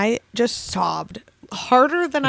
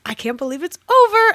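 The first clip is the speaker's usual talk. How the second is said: higher and louder, near tears; emotional outburst; 8 semitones higher, 5 dB louder, faster than usual. Real, not acted. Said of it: "I'm about to cry again".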